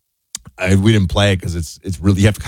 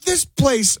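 put-on higher, gushing tourist voice without accent. Host quoting World Cup tourists, gushing higher. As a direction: play the delighted visitor. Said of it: "I won't do their accents".

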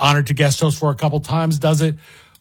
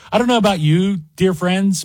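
usual speaking voice

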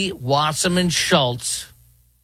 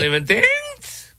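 "Believe in things?" squeaked very high and slow; scoffing echo, pitched right up; mock incredulous; slower than usual. Real, not acted. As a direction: echo it back in disbelief, way up high.

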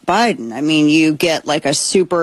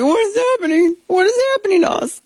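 much higher mock-panicked voice; panicked markets. Host voicing the freaking-out markets in a high mock panic. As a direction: squeal it like frightened investors. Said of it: "They're like".

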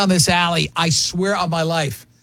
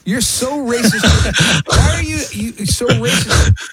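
mock outrage; 4 semitones higher, 3 dB louder than usual. Mock outrage at cohost's joke.

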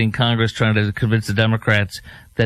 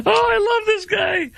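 very high, laughing squeal; gleeful squeal. Real, not acted. Host delighted by the candidate's denial, gleeful squeal.

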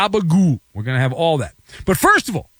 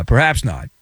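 ordinary delivery

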